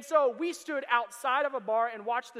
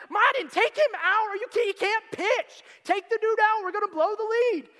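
high, loud exasperated yell at the manager; frustrated fan; 9 semitones higher, 11 dB louder than usual. Retold or imitated. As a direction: shout at the TV like a fed-up fan. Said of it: "I'm like".

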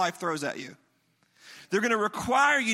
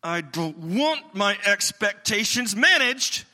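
angry customer; 2 semitones higher, 5 dB louder, slower than usual. Retold or imitated. Angry customer cutting off the support guy, loud and demanding. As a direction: mimic an irate customer insisting. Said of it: "She cut me off".

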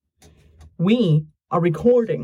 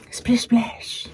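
playful whisper; 6 semitones higher, 3 dB quieter than usual. Real, not acted.

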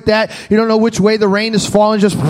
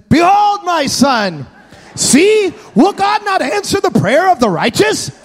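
higher shouted boast; triumphant proclamation; 7 semitones higher than usual. Pretend. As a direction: proclaim like a prophet. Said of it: "I would like to tell you that I stood there and said".

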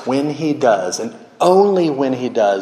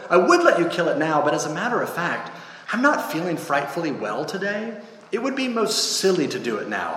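higher, wheedling ghost voice; evasive ghost; 6 semitones higher, faster than usual. Acted. Ghost puts the angel off until another day, high and evasive. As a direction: make excuses in a raised, anxious pitch.

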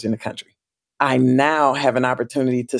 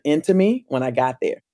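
ordinary talk, nothing marked

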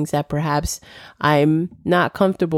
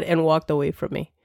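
ordinary delivery

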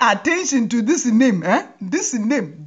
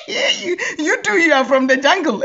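normal talking voice

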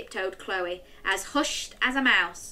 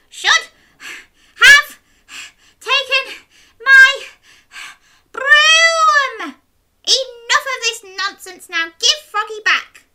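very high, loud, breathless witch; 12 dB louder than usual. Acted. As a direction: pant it out like a furious witch. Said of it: "She panted and puffed".

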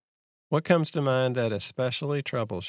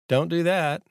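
a sharp inner warning, louder; anxiety snapping "Don't do that"; anxiety's warning voice; 6 semitones higher, 4 dB louder than usual. Retold or imitated. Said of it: "Then anxiety says".

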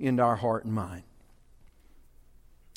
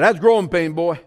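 wrong grandpa take; 4 semitones higher, 6 dB louder than usual. Retold or imitated. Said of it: "No, he didn't say it like that".

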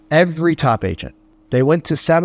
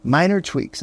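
normal talking voice

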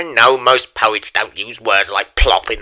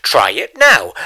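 plain reading voice

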